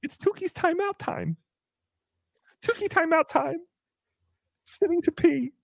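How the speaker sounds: high, slow taunting sing-song; singsong mockery of a friend. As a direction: tease like a playground chant. Mocking Tukey sing-song chant.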